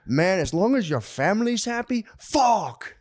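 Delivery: imitated Puerto Rican accent, pitched high; comic thick-accented Puerto Rican impression. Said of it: "He has such a thick accent".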